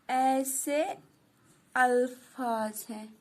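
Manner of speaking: slow word-by-word dictation, pausing between words. Shown as a demonstration. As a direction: dictate the definition so the class can write it down. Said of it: "पहले मैं यहाँ पे इसकी definition लिखूँगी". (धीरे धीरे शब्द-शब्द लिखवाना)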